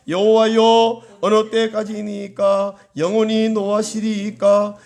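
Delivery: measured, drawn-out scripture reading; slow reading. Real, not acted. Preacher reading a psalm to the congregation, slowly. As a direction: read the verse slowly, stretching the phrases. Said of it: "천천히 읽겠습니다".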